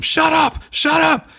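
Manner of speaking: high, loud, snapping shout; God screaming at his creations to stop; furious shout. Retold or imitated. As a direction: yell it to silence them. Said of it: "he starts telling them".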